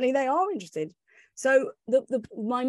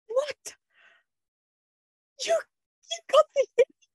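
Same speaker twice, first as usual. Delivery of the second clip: much higher, shocked boss; stunned disbelief; 3 dB louder than usual. Retold or imitated. The editor hearing she got the interview, stunned disbelief. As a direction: cry out in sheer disbelief.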